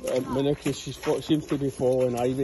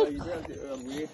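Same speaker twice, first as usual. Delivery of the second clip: playful high warning; 14 semitones higher, faster than usual. Real, not acted.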